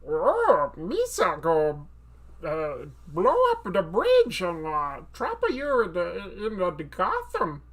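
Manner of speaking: loud, slow, halting creature villain; drawn-out, stumbling cartoon threat; Jar Jar Binks as Bane. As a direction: mash a goofy alien into a grandiose villain. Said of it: "Jar Jar Binks, but it's actually Bane".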